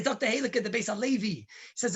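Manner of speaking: usual speaking voice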